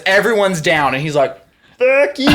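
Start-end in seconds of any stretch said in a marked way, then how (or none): 1.8-2.3 mock defiant drunk. Drunk guy flipping off shooter.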